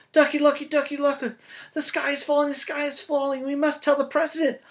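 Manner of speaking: very high, loud duck panic; high panicked duck; quacking duck. Acted. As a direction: squawk it out like a frantic duck. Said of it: "quacked Ducky Lucky".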